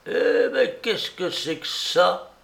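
put-on puzzled old man, drawn out; muffled old man. A narrator's reading. Old Norbert spotting something at the door, muffled slow voice. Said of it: "la voix sourde du vieux Norbert".

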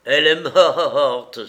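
loud, slow gleeful shout; gleeful cry. Acted. The bandit crows Florence's death at Lupin, gleeful shout. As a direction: crow it with savage joy. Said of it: "s'écria le bandit avec un redoublement de gaieté".

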